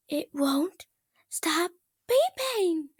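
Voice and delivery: high, loud, slow exasperated wail; furious cry. Acted. Twilight furious at her toaster, wailing high. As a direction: princess furious at the beeping toaster. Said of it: "her face contorted with the fury".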